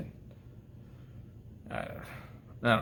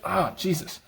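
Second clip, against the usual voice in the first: ordinary talk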